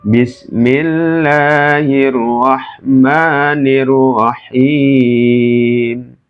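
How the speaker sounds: very slow, drawn-out Bismillah with tajwid; Quran recitation. Shown as a demonstration. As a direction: stretch each syllable as in recitation. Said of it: "Contoh sekali lagi".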